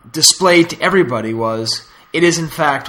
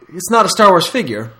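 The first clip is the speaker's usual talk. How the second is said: ordinary talk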